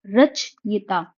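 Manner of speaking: रचयिता said slowly as रच then यिता; syllable by syllable. Shown as a demonstration. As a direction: slowly spell out रचयिता for students. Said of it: "रच पहले आएगा, फिर यिता". (रचयिता धीरे-धीरे, रच-यिता अलग करके)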